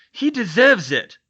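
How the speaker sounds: loud, high straining protest; Neville, held back, protests loud and high; angry. Acted. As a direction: fight against the hands holding you. Neville straining to break free.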